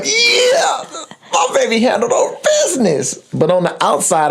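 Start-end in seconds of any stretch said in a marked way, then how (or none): 0.0-3.2 high, squealed mock celebration; gleeful high-pitched inner cheer; secretly proud dad. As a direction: cheer as a dad bursting with hidden pride. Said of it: "on the inside, I'm going".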